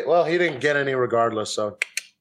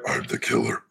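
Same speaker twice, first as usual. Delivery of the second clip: whisper; 4 semitones lower, 5 dB quieter than usual. Retold or imitated. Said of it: "was like".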